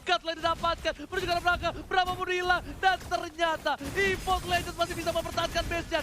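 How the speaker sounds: loud, high shouting call; live play-by-play shout. Acted. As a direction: scream the comeback to the crowd. S0 calls the decisive fight.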